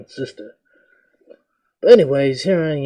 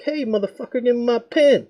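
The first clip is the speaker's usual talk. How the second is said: much higher, mock-tough demand; pushy demand; 10 semitones higher than usual. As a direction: lean in and demand it back, voice up high. Demanding pen from classmate.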